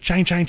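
high chain chain chime sound; imitated chime. Describing the mystery button, high chime imitation. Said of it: "super loud".

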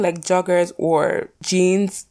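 ordinary talk

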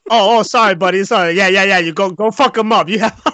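hammed-up Chucky, sorry then hyped; apologetic Chucky voice. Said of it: "There's like".

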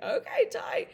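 very high, loud, chirpy reply; sudden high-pitched burst of relief; excited squeal. Retold or imitated. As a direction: squeal your delighted agreement. Retold relieved reply on phone.

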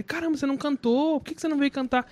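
high-pitched, astonished imitation; retold surprised questioning. Pastor asking friend's son why he didn't sing, surprised. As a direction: press the question in mock astonishment.

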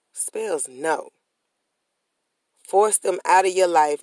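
ordinary talk

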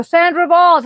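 high, loud name announcement; imitating someone calling an act to the stage; stage introduction.

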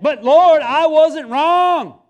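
loud, high, drawn-out protest; indignant shout. Retold or imitated. Preacher shouting his protest at the Lord. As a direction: yell it out slowly, stubbornly insisting. Escalated protest to God, retold.

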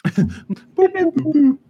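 high electronic boops. Retold or imitated. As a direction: beep like a hacking gadget. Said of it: "the sound of Sombra hacking us".

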